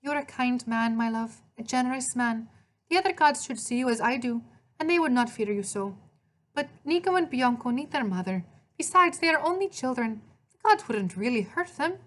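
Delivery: high, gentle Italian woman's voice; Italian accent. A narrator's reading. Maria pleading for her children, Italian accent. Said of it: "she spoke with an Italian accent".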